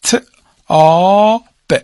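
ت، آ، ب each said separately, with a held آ; sounded out. Shown as a demonstration. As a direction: sound out ت، آ، ب one sound at a time. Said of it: "چی بخونم؟".

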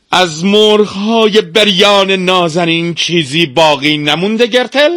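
high, slow pleading question; desperate master. Acted. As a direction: draw it out, rising to an anxious peak. Master desperately asks about the chickens.

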